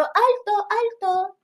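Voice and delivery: high lightly voiced alto, alto; a hint of a posh lady; posh woman calling. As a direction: play a prim lady halting the bus. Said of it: "una mujer que para la micro".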